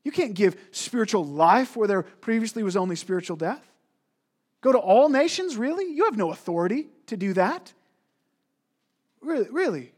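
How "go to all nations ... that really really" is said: incredulous. Acted. Preacher voicing incredulous reaction.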